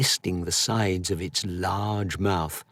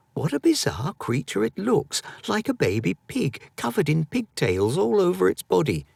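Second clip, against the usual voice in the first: put-on higher, wide-eyed boy; amazed boy; 9 semitones higher than usual. A narrator's reading. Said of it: "said Edwin".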